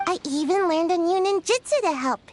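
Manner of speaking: ordinary talk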